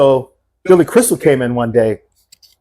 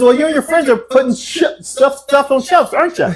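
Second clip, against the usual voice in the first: laughing mock; 10 semitones higher than usual. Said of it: "He goes".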